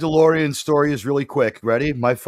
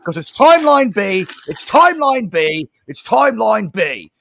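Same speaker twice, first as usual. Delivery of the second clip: complaining moviegoer; whiny protest; 5 semitones higher, 4 dB louder than usual. Retold or imitated. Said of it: "nobody walked out of the movie saying".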